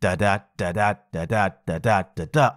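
low, bouncing da-DA pairs, accent on each second DA; short-long da-DA chant, like a drum shuffle; shuffle rhythm demo. Shown as a demonstration. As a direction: tap out five iambs with the voice. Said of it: "when I do this".

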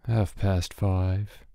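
slow, soft, pitiful little voice. A narrator's reading. Schoolboy angling for sympathy from the headmaster's wife, pitiful. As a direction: drag it out to win sympathy. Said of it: "in his most pitiful voice".

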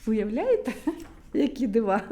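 ordinary delivery, nothing marked

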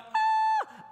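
a sudden, very high, loud shriek of panic; panicked scream. Acted. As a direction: shriek like a terrified teenage boy. Young brothers panic at killing.

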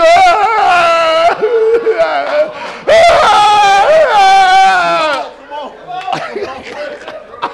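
long, high hammed-up wail; loud wailing cry. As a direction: bawl loudly and at length. Acted wail after the scolding.

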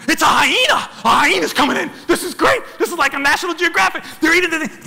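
hyped-up storyteller, voice jumping up; excited sighting. Retold or imitated. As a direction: relive the thrill of spotting it. Acts out spotting the hyena.

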